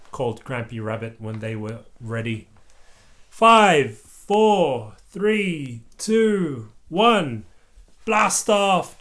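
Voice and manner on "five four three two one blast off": higher, louder countdown to blast off; excited countdown. Acted. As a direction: count down cheering as a group. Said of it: "cheered all the little astronauts".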